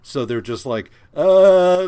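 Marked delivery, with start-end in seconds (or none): 1.2-1.9 loud, drawn-out mocking uh; higher, much louder, stretched-out dopey stall; mock dumb excuse. Host mimics writers lazily wrapping up Thor, mocking.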